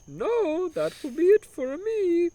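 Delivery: high, slow mock Italian order; Italian accent order. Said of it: "obnoxious broken Italian accent".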